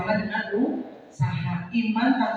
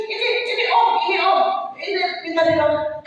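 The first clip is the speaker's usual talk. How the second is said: high, raised, insistent calling out; acted-out pushy friends, pitched up; eager friends; 7 semitones higher than usual. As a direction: pipe up urgently to get the older man's attention. Friends eagerly point out their colleague.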